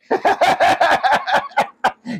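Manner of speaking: cracks up at his own comeback; real hearty laugh. Real, not acted. Retelling the phone call, laughing hard.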